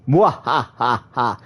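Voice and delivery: slow hammy evil laugh; villainous mwahaha. Pretend. Villain laugh after boast.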